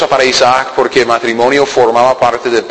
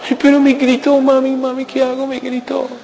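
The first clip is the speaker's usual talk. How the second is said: put-on high, whimpering girl tattling to mami, fading softer; whiny daughter voice; 11 semitones higher, 4 dB quieter than usual. Preacher mocking a young wife complaining to her mother, high whine. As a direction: cry to mommy in a high pleading whine that fades out.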